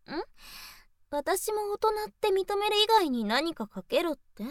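ordinary talk

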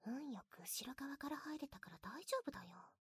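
hushed sneaking whisper. Acted. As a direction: whisper so nobody hears. Sneaking in, speaking low.

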